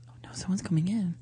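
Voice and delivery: hushed spooky whisper. Acted. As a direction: whisper it like someone's coming. Spooky bit with caller.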